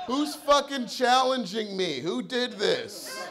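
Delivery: high, loud enraged bellow, laughing; furious yelling. Retold or imitated. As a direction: scream it like you want a fight. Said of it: "I just went".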